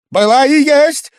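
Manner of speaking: sudden high, very loud shout; near-frenzied cry. A narrator's reading. Guest crying out about Liza, almost frenzied. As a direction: burst out at the top of the voice. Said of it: "закричал он вдруг почти в выступлении".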